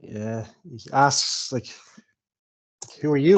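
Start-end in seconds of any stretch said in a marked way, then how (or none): 3.0-3.4 loud, lightly Scottish question; first Scottish attempt. Dwarf Balin asking who the party are, tentative Scottish accent. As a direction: try a Scots accent, loud, rising at the end. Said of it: "Do I have to do the Scottish accent?".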